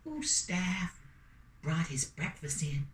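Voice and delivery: usual speaking voice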